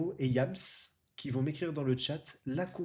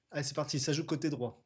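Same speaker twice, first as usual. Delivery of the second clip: ordinary delivery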